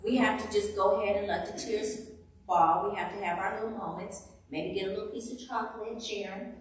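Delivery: loud, rising preacher's cry to the ladies; impassioned preaching. Acted. Preacher to the women, loud and emotional.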